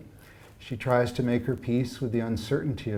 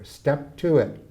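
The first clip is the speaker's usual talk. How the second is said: a clipped call, louder on Now; sharp snap; 4 semitones higher, 4 dB louder than usual. Acted. Old housekeeper scolds a server, sharply. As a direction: bark at the servant. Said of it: "the woman snaps".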